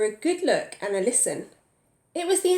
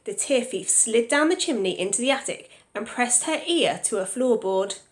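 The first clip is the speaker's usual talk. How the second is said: plain reading voice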